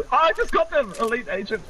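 high-pitched triumphant exclamation; excited squeal. Real, not acted. Player telling teammate about a kill, excited squeal. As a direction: burst out with it, very high.

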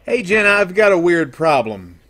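normal talking voice

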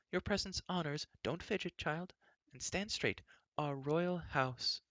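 high fussing queen, slowing at the end; a doting queen nagging mid-greeting; fussy queen. A narrator's reading. Queen scolds while welcoming.

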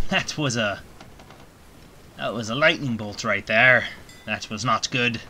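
lightly voiced pirate captain, a hint of gruff. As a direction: play a sea captain steering through a storm. Pirate talk in Sea of Thieves.

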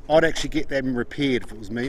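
ordinary talk

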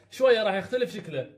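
normal talking voice

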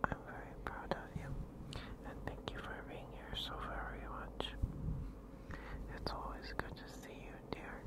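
soft close whisper to viewers; ASMR whisper. Acted. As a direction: breathe each word as a tender whisper.

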